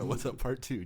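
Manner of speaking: loud, high hyped-up show intro; excited announcer. Pretend. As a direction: shout it out like opening a show.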